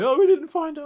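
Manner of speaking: exasperated players. Acted. Soldiers admitting they lost the monster, loud and high. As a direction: blurt it out, dismayed. Players return empty-handed.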